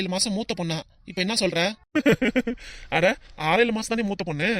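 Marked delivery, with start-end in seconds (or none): 1.6-2.9 short burst of laughter; mocking laugh. Friend hears she is only months older, mocking laugh.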